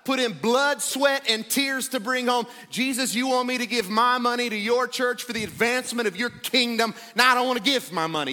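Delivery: loud, hammed-up protest over money; indignant complaining. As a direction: complain to Jesus in mock outrage. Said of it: "You ever complained against Jesus?".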